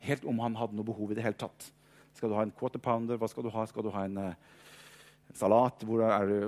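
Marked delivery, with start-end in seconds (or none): 2.2-6.4 mock hurried disciples. Disciples mock-ordering food for Jesus.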